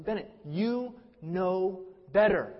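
"you know better" slowly, word by word; measured dad reproach, pausing inside "you know better"; deliberate parental reproach. Retold or imitated. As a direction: spell out YOU, KNOW, BETTER with gaps between. Father impresses point on son.

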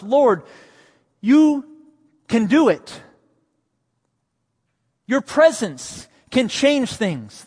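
high, loud, pleading plea to the Lord; Martha pleading. Acted. Martha pleading with Jesus, in a high, loud pleading voice. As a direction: cry out your trust to Jesus, slowing at the end.

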